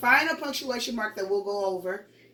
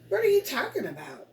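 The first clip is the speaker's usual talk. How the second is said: pitched up high as a question; questioning intonation; 8 semitones higher than usual. Shown as a demonstration. Teacher showing pupils how a question mark sounds, questioning intonation. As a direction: ask it with a high, wondering voice. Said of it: "You hear what my voice did?".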